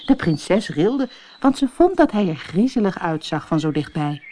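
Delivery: ordinary delivery, nothing marked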